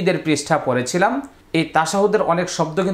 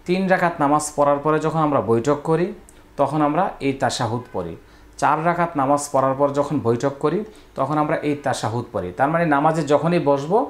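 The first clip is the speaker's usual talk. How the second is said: usual speaking voice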